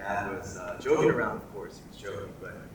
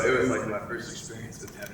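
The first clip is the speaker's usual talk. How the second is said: ordinary delivery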